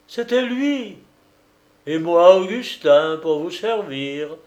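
loud, high flourish; a showman unmasking himself; triumphant reveal. Acted. Detective revealing his disguise. (révélation triomphante)

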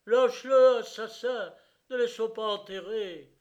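high, loud bursts of protest; angry crowd shouts. A narrator's reading. The crowd advances on the gendarmes, shouting angry demands. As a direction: shout each demand like a surging mob. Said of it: "gronda la foule en s'avançant".

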